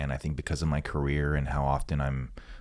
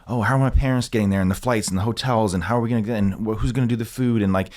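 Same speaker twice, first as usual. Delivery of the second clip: frantic worrying; 7 semitones higher, 6 dB louder, faster than usual. Retold or imitated. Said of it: "I was just so consumed with".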